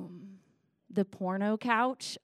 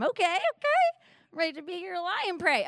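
high-pitched, giddy acceptance; eager play-acting; 8 semitones higher, 3 dB louder than usual. Playing herself offering to be his prey, giddy. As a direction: leap up high on the okays.

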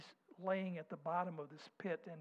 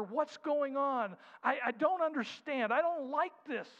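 anxious pleading; 7 semitones higher, 7 dB louder than usual. Acted. Voicing our worried complaints.

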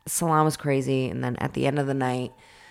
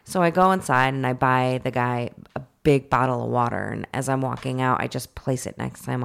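ordinary delivery

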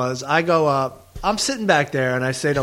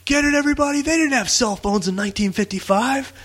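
mock heckler explaining; 7 semitones higher than usual. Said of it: "He goes".

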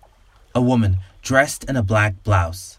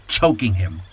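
plain reading voice

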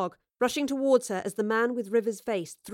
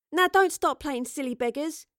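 higher, mildly scolding voice; admonishing; 6 semitones higher than usual. Acted. Astrid to a woman denying who she is, admonishing. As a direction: tell them off, pitch lifted. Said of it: "Astrid admonished".

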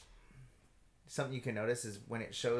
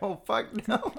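higher, laughing mock protest; laughing refusal; 10 semitones higher, 3 dB louder, much slower than usual. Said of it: "I'm like".

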